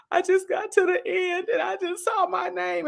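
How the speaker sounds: high teary imitation of an overwhelmed friend; tearful friend. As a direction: squeak it out, choked with emotion. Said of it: "she called me crying".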